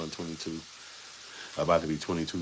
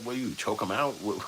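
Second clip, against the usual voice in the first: ordinary talk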